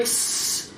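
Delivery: a quick animal hiss, no voice; squirrel hiss. Retold or imitated. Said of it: "the squirrel was still like".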